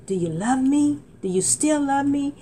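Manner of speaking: retold desperate plea, lifted pitch; retold crying out. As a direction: plead up high to God. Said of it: "I cried out with my heart".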